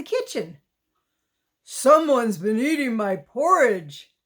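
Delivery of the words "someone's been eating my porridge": a bit louder big bear voice; lightly gruff father bear; Papa Bear bellow. Acted. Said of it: "bellowed in his great big voice".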